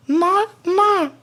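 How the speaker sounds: very high coaxing inner voice; high calming voice. Retold or imitated. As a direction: soothe yourself in a squeaky high voice. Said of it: "this is my voice that I use to calm myself down".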